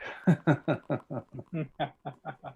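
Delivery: laughter. Acted. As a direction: laugh softly in a steady series. Men laugh at coroner's jest.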